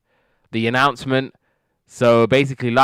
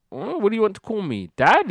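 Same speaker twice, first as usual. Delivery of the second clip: much higher questioning voice; imitated Jenny; 12 semitones higher than usual. Jenny asked to drop Mister Jefferson, querying in high voice. Acting out Jenny asking George.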